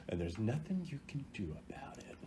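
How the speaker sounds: soft unvoiced gloating whisper; a sly whispered jab at the opponent; taunting whisper. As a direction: breathe the taunt right into their ear.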